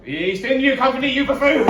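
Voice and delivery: hammed-up ranting statesman; loud high pompous bluster. Pompous ranting prime minister.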